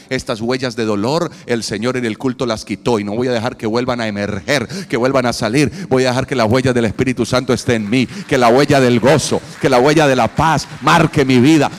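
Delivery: preaching at full force; fervent declaration. Acted. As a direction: proclaim it with rising fire.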